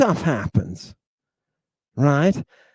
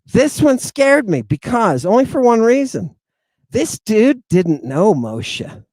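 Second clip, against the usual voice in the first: tense retelling; 6 semitones higher, 5 dB louder than usual. Real, not acted. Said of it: "I got scared".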